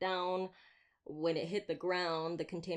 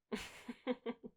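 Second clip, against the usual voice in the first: quick amused giggle; light laugh; 7 semitones higher, 5 dB quieter than usual. Acted. Slayer laughing after teasing the vampire about pets, amused. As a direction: laugh softly at your own joke.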